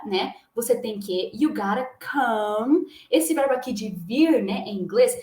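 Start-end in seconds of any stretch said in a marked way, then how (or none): 1.3-2.9 "you gotta come" spelled out very slowly, "gára come"; slow pronunciation demo. Shown as a demonstration. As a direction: drag each word out slowly, flap the t in "gotta". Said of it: "Vou mostrar aqui pra vocês primeiro a pronúncia". (pronúncia bem devagar de "you gotta come")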